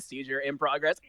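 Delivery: usual speaking voice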